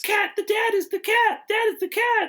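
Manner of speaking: mock child announcing the cat; imitating little girl. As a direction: squeal it like the kid. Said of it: "the little girl's like".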